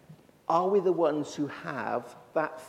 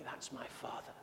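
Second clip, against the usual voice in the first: hushed declaration; 2 semitones lower, 18 dB quieter than usual. Retold or imitated. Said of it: "publicly shouting".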